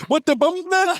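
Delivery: high mock Jamaican-Boston exclamation; Jamaican-Boston accent. Host still mimicking his friend, high Jamaican-Boston accent. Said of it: "Jamaican and he Boston".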